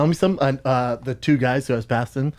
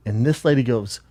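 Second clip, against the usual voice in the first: ordinary delivery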